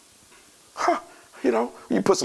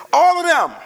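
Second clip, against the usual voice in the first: high, loud cry of all of them; shouted climax; 12 semitones higher, 6 dB louder, slower than usual. Real, not acted. Preacher shouting to the congregation about witches. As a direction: yell it out to the crowd.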